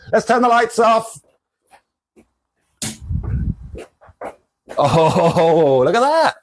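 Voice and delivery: high, awed oh look at that; delighted wonder. Real, not acted. Shop owner shows viewers the glowing lava lamp, awed.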